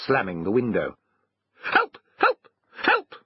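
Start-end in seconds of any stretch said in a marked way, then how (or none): 1.6-3.2 dog crying out. A narrator's reading. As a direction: yelp it in short bursts. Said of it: "cried the dog".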